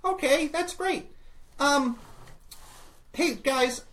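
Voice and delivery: very high, loud squeaky greeting; chipper little Modron. Acted. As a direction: pipe up as a tiny excited creature. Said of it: "and he says".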